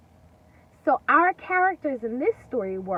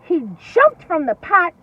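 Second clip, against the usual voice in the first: higher, louder, racing narration; excited narration; 7 semitones higher, 13 dB louder than usual. A narrator's reading. Teacher narrating the wolf fleeing, excited and loud. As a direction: build the excitement.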